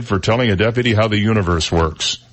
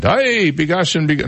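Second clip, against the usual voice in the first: a slow, higher, hammed-up Irish brogue; mock Irish accent; 8 semitones higher, slower than usual. Radio host attempts an Irish accent on air, hammed-up Irish. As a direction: try a stage Irish accent, then give up. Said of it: "no, that's, I don't do Irish".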